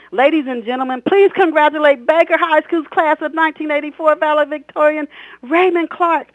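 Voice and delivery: high, loud, ringing announcer call; grand announcement. Acted. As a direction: introduce the valedictorian to the whole hall. Principal announces valedictorian.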